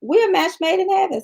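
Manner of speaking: mock delighted reply. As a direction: tease it like a happy declaration. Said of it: "I said".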